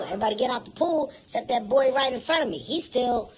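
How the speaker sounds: ordinary talk, nothing marked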